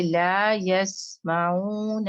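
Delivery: لا یسمعون recited very slowly; tajweed recitation. Shown as a demonstration. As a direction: draw out لا یسمعون carefully. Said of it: "عین کو ایسے کرنا ہے". (لا یسمعون کو آہستہ تلاوت کریں)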